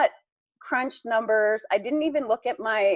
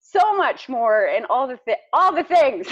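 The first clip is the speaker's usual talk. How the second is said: normal talking voice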